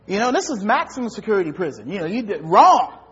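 ordinary talk

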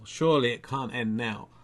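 ordinary talk

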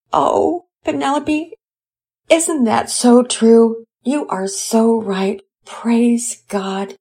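high, slow, grateful mother; moved mother voice. Acted. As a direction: linger on each word, deeply touched. Mother thanking daughter, moved.

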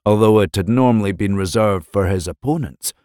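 plain reading voice

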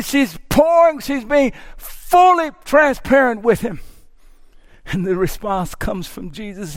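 ordinary talk, nothing marked

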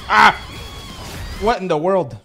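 sudden loud startled cry; startled yell. Real, not acted. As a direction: jump at the sudden noise. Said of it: "Sorry, that was really loud".

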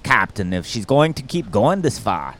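mock Scottish starship engineer; Scotty. Engineer asking the captain for crystals, mock Scottish accent. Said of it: "All I can do is Scotty".

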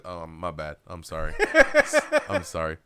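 low, deep, fake-sorry voice; mock apology. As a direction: drop low and shrug off a punch with a hollow sorry. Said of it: "He goes".

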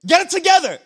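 high, hammed-up impatient scolding; mock exasperation. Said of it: "Jesus isn't like".

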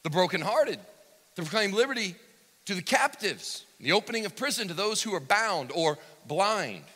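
loud, high pulpit proclamation; preaching at full force. Acted. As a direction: ring out each phrase of the prophecy to the hall. Preaching, reading Isaiah aloud.

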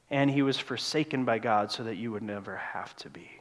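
soft, breathy whisper of awe; hushed whisper. Real, not acted. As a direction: let it fall to a whisper at the cross. Climax on the cross.

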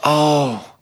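high, loud, drawn-out "oh"; gushing imitation of an excited caller; imitated delight. Wife phoning about the property, imitated delight. Retelling wife's delighted phone call.

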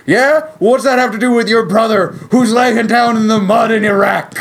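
high, loud mock indignation; outrageous parent outburst. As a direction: act out an over-the-top scolding parent. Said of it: "respond in talking in, uh, outrageous ways".

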